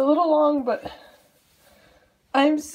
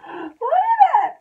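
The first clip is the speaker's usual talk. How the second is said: loud, high and drawn out; ecstatic squeal; 10 semitones higher, 6 dB louder, slower than usual. Real, not acted. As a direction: scream it slowly with delight. Overjoyed, showing off the shirt.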